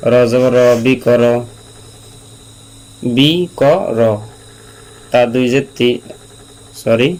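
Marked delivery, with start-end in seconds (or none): 3.1-4.0 "বি ক র" drawn out, each syllable set apart and pitched higher; teacher slowly separating "বি ক র" for learners. Shown as a demonstration. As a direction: sound out "বি", "ক", "র" slowly, one by one. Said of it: "আমি ধীরস্থিরভাবে বা আস্তে আস্তে বলি".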